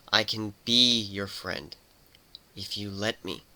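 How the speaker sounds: slow, soft, reassuring offer; soothing. Acted. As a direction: coax her gently, slowing down. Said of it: "Warren soothed".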